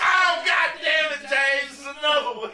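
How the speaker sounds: high, drawn-out mock panic; retold panicked yell. Telling James there's another possum, comic panic. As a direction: wail it high, half laughing.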